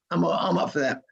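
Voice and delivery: ordinary talk, nothing marked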